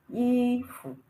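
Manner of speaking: "ifu" with high held i, short fu; neutral tone. Shown as a demonstration. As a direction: hold the i high and level, clip fu. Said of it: "there is no tone on the U".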